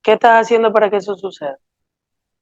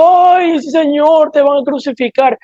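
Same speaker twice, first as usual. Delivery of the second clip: wailing women; 3 semitones higher, slower than usual. Acted. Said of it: "A las mujeres que estaban llorando".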